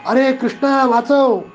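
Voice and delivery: high, frightened call to his brother; scared child calling for help; panicked cry for help. Acted. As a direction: cry out in terror for rescue. Boy on giant demon's back.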